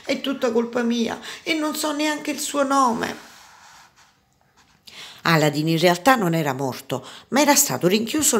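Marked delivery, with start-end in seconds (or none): 0.0-3.2 high, plaintive storybook lament; acted high-pitched despair. A narrator's reading. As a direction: blame yourself, close to tears. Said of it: "piangere disperatamente".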